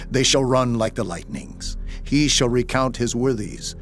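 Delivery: ordinary delivery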